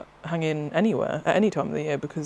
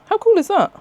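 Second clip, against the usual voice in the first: much higher and louder, gushing; excited squeal; 7 dB louder than usual. Real, not acted. Showing viewers a shotgun-shell pin badge, excited squeal. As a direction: squeal it out with delight.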